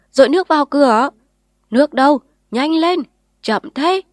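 impatient crowd yelling; frantic shouting. A narrator's reading. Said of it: "bừng tỉnh".

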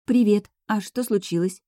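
ordinary delivery

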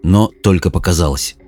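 plain reading voice

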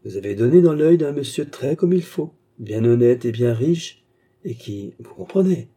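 loud, insinuating honeyed tone; hypocritical sweetness. A narrator's reading. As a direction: break it to her with oily false kindness. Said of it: "avec cette horrible douceur hypocrite". (douceur hypocrite et insinuante)

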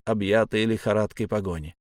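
plain reading voice

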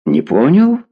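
surprised rise on "Не понял"; surprised question. A narrator's reading. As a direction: ask it taken aback, swinging up at the end. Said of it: "удивлённо поднял брови Беркович".

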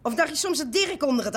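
defiant insistence. Acted. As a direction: drag it out, insisting against doubt. Houdt vol tegen wantrouwige bezoeker. (nadrukkelijk en verontwaardigd volhouden)

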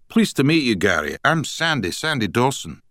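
put-on broad Scottish accent, high and bright; strong Scottish accent. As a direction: speak like a hearty Scotsman from Edinburgh. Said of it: "he added in a strong Scottish accent".

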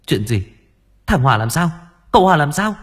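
rising panic, pitch and volume climbing; a mother's fear building with each question; alarmed. Acted. Mother demanding news of her son, rising panic.

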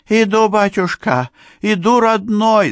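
high-pitched, loud servile cry; fawning beekeeper. A narrator's reading. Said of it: "залебезил пасечник".

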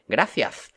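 "gracias" with each s turned into th; exaggerated Spanish lisp. Shown as a demonstration. Mimicking foreigners imitating Spaniards, "gracias" with lisped th sounds. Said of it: "imitando en español".

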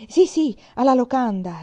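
loud, eager agreement; emphatic repetition. A narrator's reading. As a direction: seize on the idea loudly. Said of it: "ripeté il capitano".

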